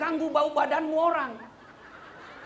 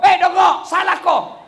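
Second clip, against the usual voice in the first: loud, higher insulting shout at the imam; rude angry yell; 4 semitones higher, 12 dB louder, slower than usual. Retold or imitated. Rude worshipper yelling at the imam, angry shout.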